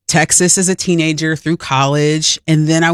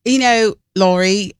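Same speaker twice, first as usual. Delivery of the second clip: put-on English accent try; 3 semitones higher than usual. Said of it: "try it right now".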